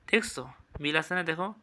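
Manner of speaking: ordinary talk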